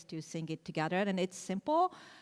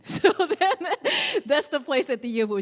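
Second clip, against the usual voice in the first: laughing; 8 semitones higher, 8 dB louder than usual. Real, not acted. Laughing while explaining.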